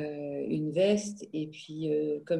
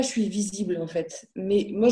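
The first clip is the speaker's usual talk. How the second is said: usual speaking voice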